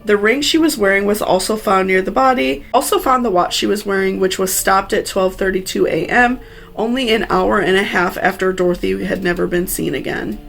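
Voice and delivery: usual speaking voice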